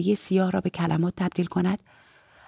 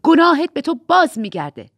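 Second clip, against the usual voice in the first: louder, higher righteous outburst; almost shouting; 6 semitones higher, 7 dB louder than usual. A narrator's reading. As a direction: raise your voice to just short of a shout. (تقریباً با فریاد)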